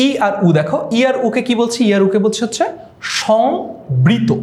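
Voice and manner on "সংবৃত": সংবৃত stretched very slowly, rising at the end; slow, teacherly emphasis on সংবৃত; drawn-out term. Shown as a demonstration. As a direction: draw out সংবৃত syllable by syllable. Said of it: "উচ্চারণটা একটু দেখো".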